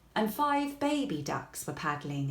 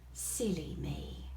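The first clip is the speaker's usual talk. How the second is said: whispered aside; 3 semitones lower, 6 dB quieter, slower than usual. A narrator's reading. Kipper scolds himself.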